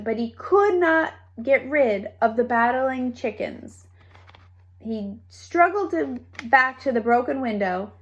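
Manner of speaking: usual speaking voice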